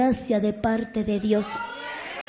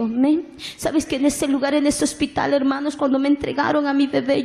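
ordinary talk, nothing marked

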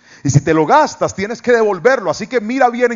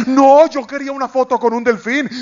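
high, pleading excitement; eager wish; 8 semitones higher than usual. Retold or imitated. Pastor insisting he wants the dolphin photo, high eager voice.

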